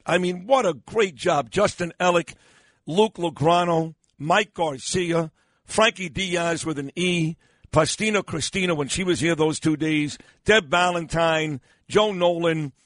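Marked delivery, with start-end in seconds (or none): none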